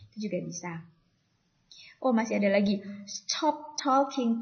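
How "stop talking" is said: "Stop talking" drawn out, pitched up; somewhat raised tone. Shown as a demonstration. Teacher models hushing chatters, "Stop talking" slow with raised tone. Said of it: "agak meninggi gitu ya".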